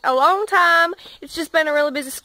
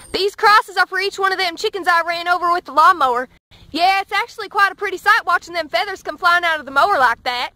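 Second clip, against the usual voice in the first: put-on southern accent. Hillbilly farm kid joking to camera, light Southern accent. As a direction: play a deadpan Southern country boy, lightly. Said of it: "thank heavens I have my southern accent".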